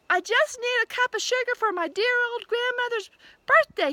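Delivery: high, loud pleading wolf voice. A narrator's reading. Wolf begging the pig for sugar in a high, loud voice. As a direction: beg for the sugar.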